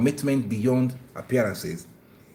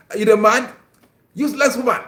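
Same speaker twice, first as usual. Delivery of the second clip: loud, higher mock yell; mock harsh insult; 11 semitones higher, 9 dB louder, faster than usual. Shown as a demonstration. Husband insulting his wife, loud hammed-up yell. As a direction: ham up a contemptuous husband. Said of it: "It's not like".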